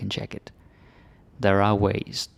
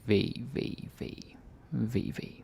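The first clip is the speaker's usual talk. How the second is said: whisper; 8 dB quieter, slower than usual. Shown as a demonstration. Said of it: "fading whispered repetitions".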